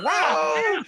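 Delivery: mock amazed. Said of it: "I'm like".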